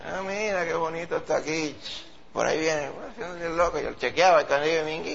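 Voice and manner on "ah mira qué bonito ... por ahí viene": playing dumb. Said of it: "haciéndome el bobo".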